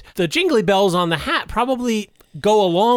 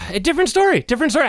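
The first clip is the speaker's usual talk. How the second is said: clown voice; 11 semitones higher, much faster than usual. Retold or imitated. Echoing in clown voice.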